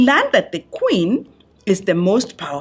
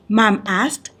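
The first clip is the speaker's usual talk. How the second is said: ordinary delivery, nothing marked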